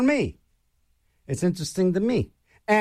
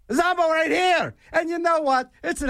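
announcer call-out; 9 semitones higher than usual. Acted. Radio host calls out show name.

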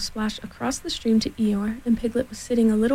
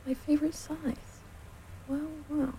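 soft, higher, resigned murmur; sad, to himself; 5 semitones higher, 11 dB quieter than usual. A narrator's reading. Eeyore mourning the burst balloon, sadly talking to himself. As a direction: sigh over it quietly, to yourself. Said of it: "he said to himself sadly".

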